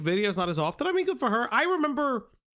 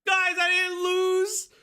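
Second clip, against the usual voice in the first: excited shouting; 11 semitones higher than usual. Real, not acted. Streamer reacts in mock outrage.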